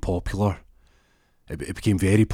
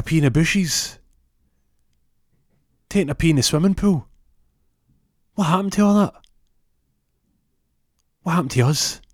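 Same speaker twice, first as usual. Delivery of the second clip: mock-heroic rallying cry, pitched high; impassioned speech; 7 semitones higher, 3 dB louder than usual. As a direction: build a high, aggrieved plea with long pauses. Acted impassioned courtroom speech.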